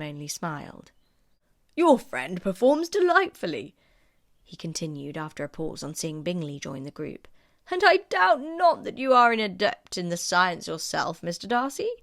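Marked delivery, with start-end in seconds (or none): 1.8-3.8 put-on higher, louder fawning old gentleman; a higher, brighter-pitched courtier voice; courtly Sir William. 7.7-11.9 put-on higher, louder fawning old gentleman; a higher, brighter-pitched courtier voice; courtly Sir William.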